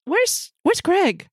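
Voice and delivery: high, hesitant character voice; flustered singer. Acted. Game master voices B turning back.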